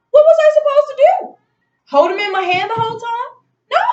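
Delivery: high, loud incredulous question; indignant. Real, not acted. Telling off the store staff, incredulous. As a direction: sound outraged at the idea.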